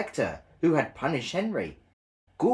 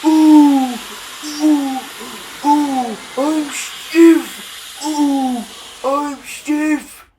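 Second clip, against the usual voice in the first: very high, loud creaky groans; groaning; 11 dB louder, much slower than usual. A narrator's reading. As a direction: moan like stiff rusty joints. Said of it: "he groaned".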